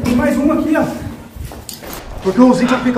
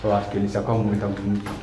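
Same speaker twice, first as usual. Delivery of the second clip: hushed; 12 dB quieter, faster than usual. Real, not acted. Cochichando depois do exorcismo.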